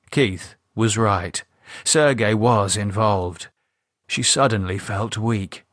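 ordinary delivery, nothing marked

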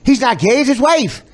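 hammed-up mock reasoning; mocking sarcasm. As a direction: sneer it like a bad excuse. Mocking defence in loud voice.

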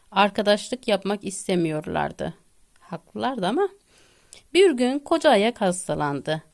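ordinary delivery

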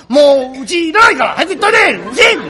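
high, loud scolding shout; 女房の怒鳴り声. Acted. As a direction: drive the husband out with a yell. 女房が亭主を追い出す. (甲高く怒鳴って追い立てる)

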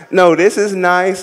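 ordinary delivery

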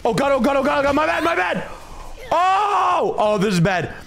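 high, shouted panic, oh God and no no; terrified gibbering. Real, not acted. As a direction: scream and babble in real panic. Screams while losing the fight.

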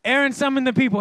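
ordinary talk, nothing marked

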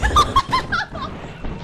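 shrill hysterical laughter after a scare; high hysterical laugh. Real, not acted. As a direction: burst into relieved laughter.

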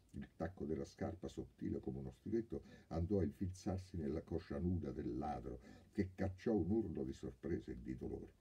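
usual speaking voice

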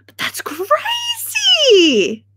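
very high, drawn-out squeal of disbelief; exaggerated disbelief. Real, not acted. Streamer reacting to a viewer, exaggerated high-pitched disbelief. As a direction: stretch it right out, way up high.

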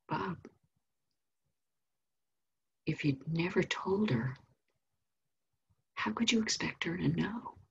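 soft, unhurried motherly reasoning; calm, loving. Retold or imitated. Mom gently correcting her son, calm and loving. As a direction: say it softly, with pauses, gently reasoning. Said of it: "in her calm, loving way".

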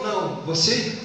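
usual speaking voice

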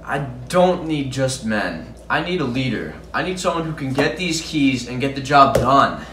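stern, emphatic, building to a loud finish; forceful demand. Acted. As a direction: lay down the law to a subordinate, building in force. Rejects offer, demands a leader.

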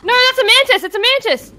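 alarmed shout. Real, not acted. Correcting in panic.

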